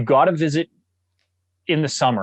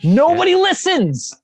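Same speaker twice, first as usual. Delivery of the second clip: much higher, louder, slow exasperated cry; exasperated exclaim; 5 dB louder than usual. Acted. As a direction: burst out in mock frustration. Punchline after mock announcement.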